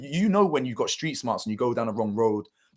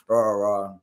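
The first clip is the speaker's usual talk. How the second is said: ordinary talk, nothing marked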